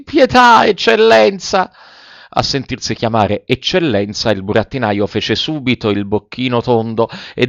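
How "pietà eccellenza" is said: very high, drawn-out begging; slow, high imploring puppet; pleading. A narrator's reading. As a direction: beg for mercy, stretching the title slowly. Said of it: "con voce supplichevole".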